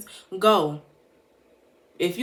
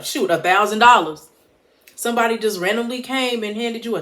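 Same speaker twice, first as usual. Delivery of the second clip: excited outburst; 4 semitones higher, 7 dB louder than usual. Real, not acted. The idea of a surprise gift.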